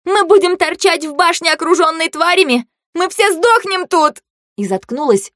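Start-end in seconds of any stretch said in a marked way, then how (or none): 0.0-4.2 high, hysterical protest; frantic high-pitched outburst; panicked shrieking. A narrator's reading. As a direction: screech it in alarm, stretching the end. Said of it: "взвизгнула Фэю".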